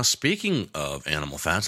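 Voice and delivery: ordinary talk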